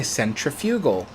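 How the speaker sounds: "centrifugal" as sen-tri-FYOO-gal; non-American pronunciation. Shown as a demonstration. He cites other places' way, "centrifugal" with a long FYOO. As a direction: put the stress on FYOO in "centrifugal". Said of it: "in other places of the world".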